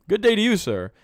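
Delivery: put-on high, loud British gentleman's greeting in a British accent; a British toff's hearty hello in a British accent; posh British accent. Read out for a viewer, in a loud, high British accent. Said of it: "You were using my accent?".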